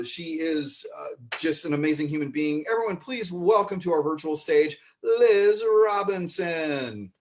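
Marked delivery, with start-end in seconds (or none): 2.7-7.1 big build to a drawn-out name; announcer introduction. Real, not acted. Host welcoming a founding member to the virtual stage, announcer style. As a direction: stretch the name out like a show emcee.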